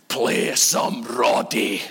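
put-on low, very slow slurred old-timer; slow, deep drunken request; drunk old man. Old guy at a club demanding a song, slurred and low. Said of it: "this old guy came up onto the stage".

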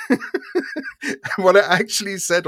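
half-laughing as the story builds; laughing while setting up the punchline; chuckling through it. Real, not acted.

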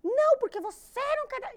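very high, loud mother voice; imitated shrieky accusation. Grandmother accuses daughter of not feeding baby, high and loud. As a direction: mimic a nagging mother at full pitch.

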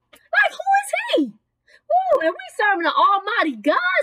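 high, squealed preacher's disbelief; indignant, pitch flying up then settling; incredulous preaching. Acted. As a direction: squeal it like you cannot believe the nerve.

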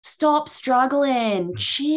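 drawn-out villain line. Retold or imitated. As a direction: mimic the pirate dragging his words out. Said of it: "dragging out every syllable of every word".

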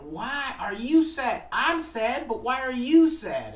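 put-on storyteller's octopus voice for kids. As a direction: ask gently but high and bright-eyed. Octopus asks crying fisherman.

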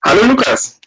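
overdriven mic, blown-out greeting; loud greeting that clips the mic; clipping distorted mic. Real, not acted. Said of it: "du übersteuerst grad ein bisschen".

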